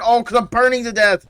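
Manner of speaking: high loud panicked cry; panicked yell. Real, not acted. Burning in game.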